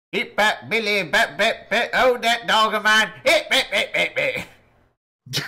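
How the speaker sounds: laughing, half-sung Southern mush-mouth; thick Southern gibberish. Retold or imitated. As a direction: mumble it like a rural Southern guy hollering from a truck. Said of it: "I can do you one better".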